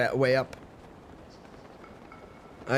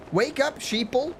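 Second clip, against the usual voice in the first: same call, pitched much higher and shriller in mockery; mock conspiracy preacher, higher; 9 semitones higher than usual. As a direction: push the pitch up on the repeat.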